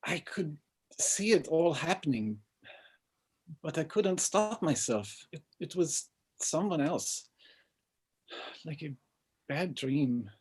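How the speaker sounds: soft, hushed and troubled; quiet confession. Acted. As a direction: murmur it, ashamed and scared. Confessing his frightened story.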